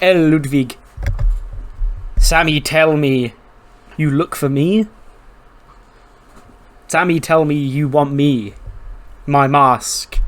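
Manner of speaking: a wrestler in a broken Mexican accent, slightly hammed. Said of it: "in his broken Mexican accent".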